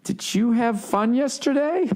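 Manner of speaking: high, slow, falsely cheerful; overly sweet question. Retold or imitated. Hungover husband sweetly fishing to his angry wife, high and slow. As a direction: tiptoe around her with a sugary voice.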